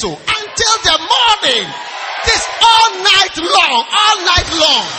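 loud, slow, drawn-out shouted preaching; full-force preaching. Acted. Preacher proclaiming the verse, slow loud shouting. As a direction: stretch each phrase out over the crowd.

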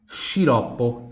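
sciroppo said slowly, shi-ROP-po; slow Italian teacher model. Shown as a demonstration. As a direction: draw out sciroppo. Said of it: "Repita, sciroppo".

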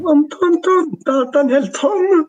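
high squeaky silly voice. Pretend. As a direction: pitch way up, playful. Playing with a voice effect.

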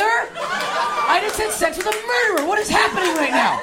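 high, stretched panicked outburst; horrified freak-out. Acted. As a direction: wail it in comic disbelief. Horrified reaction to the song.

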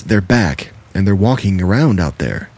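ordinary delivery

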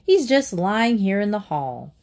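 ordinary delivery, nothing marked

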